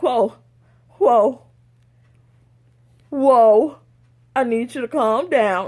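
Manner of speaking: loud, spaced-out protest; emphatic halting. Retold or imitated. Boss told employees think she's pregnant, halting them loudly. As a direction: stop them firmly with big, separate exclamations.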